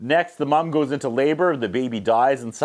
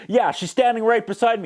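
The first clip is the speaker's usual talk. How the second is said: higher mock sarcastic snap; snapping sarcasm; 9 semitones higher than usual. Dad snapping at his boss, mock sarcasm. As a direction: bite it back sarcastically. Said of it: "And he snaps".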